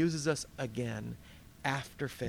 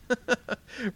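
high amused chuckle; a short laugh at Peter's pride; 9 semitones higher than usual. Real, not acted. Preacher laughing at Peter's misplaced zeal, amused chuckle.

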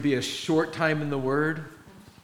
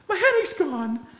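much higher, louder astonished cry; amazed girl; 4 dB louder than usual. Retold or imitated. Girl telling the counselor her headache is gone, amazed and high. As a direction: burst out in wonder.

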